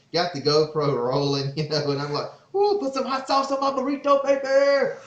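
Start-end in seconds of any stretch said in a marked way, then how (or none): 2.5-4.9 high, loud falsetto yell of the burrito line; yelling movie quote. Retold or imitated. Yelling the quote on the GoPro after the hunt, high falsetto. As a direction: shriek it way up, giddy and pumped after the limit. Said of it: "I'm just yelling".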